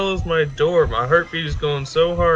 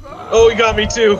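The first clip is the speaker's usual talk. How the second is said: startled yell; 7 semitones higher, 6 dB louder than usual. Real, not acted. Caught by the monster too.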